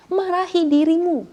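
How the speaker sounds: high, loud commanding cry; preacher urging congregation, loud and fervent; preaching shout. Real, not acted. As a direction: hit it hard like a command. Preacher commands self-rebuke.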